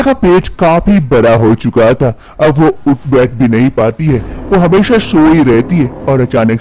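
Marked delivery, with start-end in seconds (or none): none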